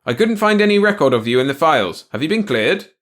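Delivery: high, loud interrogation; urgent. A narrator's reading. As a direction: shout with a note of urgency. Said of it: "note of urgency in his voice".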